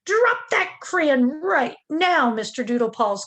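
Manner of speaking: mother ordering her kitten to drop the crayon; stern mama command. A narrator's reading. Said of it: "commanded Mama June Bug Jones".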